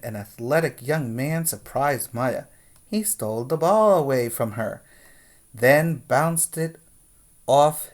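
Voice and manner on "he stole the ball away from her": usual speaking voice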